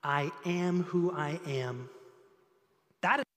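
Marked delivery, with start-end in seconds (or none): 0.0-1.9 low, slow and hushed, weighty; reverent. Acted. Pastor reads God's answer to Moses, low and slow. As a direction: let each word land slowly. God's name, first reading.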